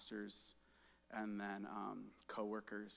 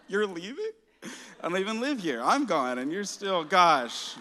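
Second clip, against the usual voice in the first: incredulous outburst; 9 semitones higher, 17 dB louder than usual. Retold or imitated. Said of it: "I'm like".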